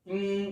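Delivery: इं sounded alone, a nasal hum; isolated nasal. Shown as a demonstration. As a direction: pull out the bare sound इं. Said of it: "तो ये जो आवाज आ रही है". (अकेली ध्वनि इं)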